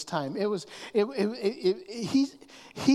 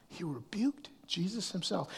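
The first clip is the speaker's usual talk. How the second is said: whisper; 7 dB quieter than usual. Real, not acted. Hushed build to emphasis.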